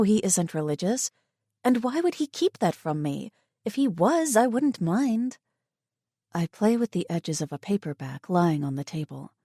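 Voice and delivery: plain reading voice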